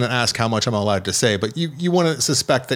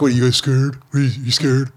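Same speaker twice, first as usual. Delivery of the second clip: slightly raised, teasing needle; mocking taunt; 3 semitones higher than usual. Drunk guy needling his friends in haunted house, mocking. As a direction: poke fun at scared friends. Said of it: "make fun of things".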